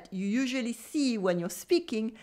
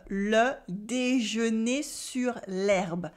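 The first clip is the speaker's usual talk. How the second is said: le déjeuner sur l'herbe, slowed down; slow model; slower than usual. Shown as a demonstration. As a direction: stretch the title for learners. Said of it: "repeated slowly for learners".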